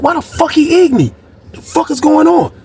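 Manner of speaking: high, baffled outburst; shocked disbelief. Retold or imitated. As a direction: replay the moment it made no sense. Narrator voicing his confused reaction.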